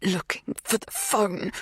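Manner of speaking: slow, halting, effortful words; dry-throated. A narrator's reading. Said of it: "scrape some words past the dryness".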